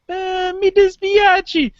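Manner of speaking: high, slow Italian apology; hammed-up Italian accent, slow and high; mock hesitant Italian. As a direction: stretch out a sheepish Italian apology. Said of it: "you're just like".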